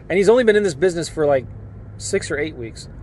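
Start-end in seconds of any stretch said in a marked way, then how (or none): none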